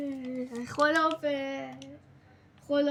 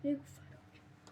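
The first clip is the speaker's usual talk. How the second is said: whispered, much softer; 2 semitones lower, 13 dB quieter than usual. Real, not acted. Gamer repeating his line as a hushed whisper. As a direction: drop it to a secret whisper.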